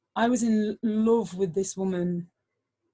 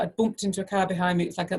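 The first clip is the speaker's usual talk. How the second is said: ordinary talk, nothing marked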